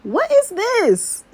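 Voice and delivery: high, slow, loud double take; mock-shocked wait, what is this; surprised. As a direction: stretch it out in stunned disbelief. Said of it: "but then I'm like".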